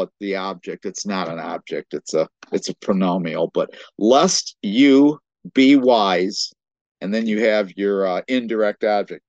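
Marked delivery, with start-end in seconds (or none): 4.0-6.5 very slow, loud, separated words. Shown as a demonstration. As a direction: spell out the verse one part at a time. Breaks the verse into grammatical parts.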